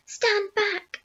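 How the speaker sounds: high urgent warning call; shouted warning. A narrator's reading. Aunt warning the others back, high urgent shout. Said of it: "Aunt Sponge shouted".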